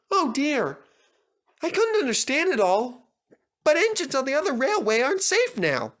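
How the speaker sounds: high, eager little engine voice; Percy voice. Acted. Percy boasting to the other engines, eager high voice.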